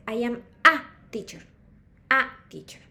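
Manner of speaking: slow, loud A set apart before teacher; stress on A. Shown as a demonstration. Teacher demonstrating the English article, stress on A. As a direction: punch the A each time. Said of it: "Entonces yo digo en inglés".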